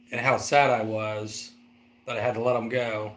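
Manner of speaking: usual speaking voice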